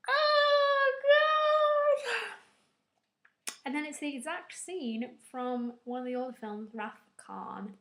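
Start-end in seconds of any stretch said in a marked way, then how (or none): 0.1-2.3 much higher, loud despairing wail; anguished cry. Retold or imitated. Gasp then anguished cry.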